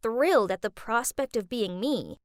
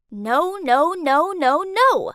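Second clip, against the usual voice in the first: a chant of no, climbing louder and higher; exasperated refusals; 4 semitones higher, 6 dB louder, slower than usual. Acted. Teen mimicking mom's strict rules, exasperated. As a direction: reel off rising, emphatic no after no.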